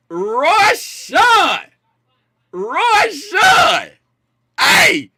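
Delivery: shouted name call, high and loud; loud shout-out to a chat viewer. Real, not acted.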